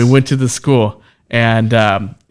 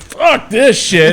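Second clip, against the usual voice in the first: slow, higher, drawn out; fed-up groan; 7 semitones higher, 5 dB louder, much slower than usual. Retold or imitated. Rookie wrestler worn out from running ropes, slow and fed up. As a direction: drag it out like you're spent.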